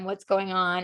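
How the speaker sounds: normal talking voice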